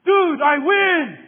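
triumphant shout. Retold or imitated. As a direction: crow it out like a bully who just won. Bully claims victory.

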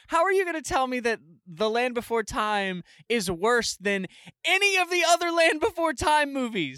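indignant rant, pitch climbing high; insulted outrage. Retold or imitated. Fan objecting to how the sequels were ranked, insulted outrage. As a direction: protest in high-pitched disbelief. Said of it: "I was so insulted".

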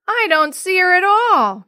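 loud, high, drawn-out wail. A narrator's reading. Jenny to the searching mom, wailing. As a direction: cry it out in distress, slowly. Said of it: "Jenny wailed".

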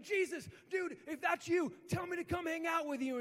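eager buddy begging to join; excited. Retold or imitated. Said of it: "he's in excitement".

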